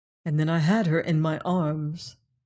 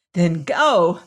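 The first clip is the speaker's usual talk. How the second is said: crying out; 7 dB louder than usual. A narrator's reading. Joanna sending away the man who cannot love her, crying out. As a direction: cry it out as a dismissal. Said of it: "cried she".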